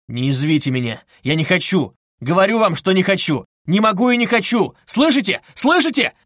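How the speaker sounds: climbing, loud, high-pitched refusals; angry shout. A narrator's reading. Suspect banging table at investigator, angry shouting. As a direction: escalate to shouted demands to be heard. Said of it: "крикнул он, стукнув опять кулаком по столу".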